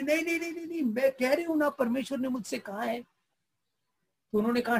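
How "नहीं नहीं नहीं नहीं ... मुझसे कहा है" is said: high, insistent no no no; stubborn insisting. Acted. Old woman insisting to the church leaders, high and stubborn. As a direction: dig in your heels, she won't budge. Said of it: "तो वो जिद पे अड़ी".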